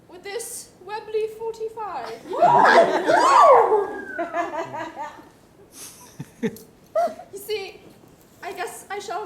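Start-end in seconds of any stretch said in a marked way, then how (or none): 2.2-7.3 loud real laughter. Real, not acted. Cast cracking up at the Webley line, loud laugh. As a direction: break up laughing.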